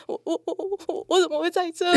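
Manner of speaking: high, stuttering mock sob; high-pitched crying wail, played for laughs; retold tearful stammer. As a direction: imitate yourself blubbering, words catching over and over. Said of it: "然后我就大哭".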